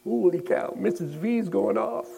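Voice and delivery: high, excited gasp of disbelief; shocked kids, voice jumping up high; amazed students. Retold or imitated. Students watching Liz, high excited amazement. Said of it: "the students are all like".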